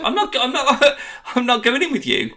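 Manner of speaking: very high, laughing mock protest; laughing refusal. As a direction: refuse while cracking up. Rob's laughing refusal retold.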